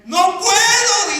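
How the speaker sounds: high, loud, drawn-out protest; hammed-up wail of refusal; anguished refusal. Betrayed husband telling his pastor he can't, anguished.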